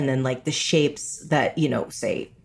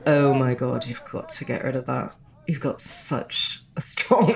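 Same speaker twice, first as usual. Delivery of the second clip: British classmates; 4 dB quieter than usual. Retold or imitated. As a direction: imitate appalled British drama students. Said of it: "they just were like".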